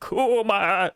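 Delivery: lightly voiced old man, drawn out; older Saturn. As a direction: call the name out long, lightly playing old. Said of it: "he got older and he's like".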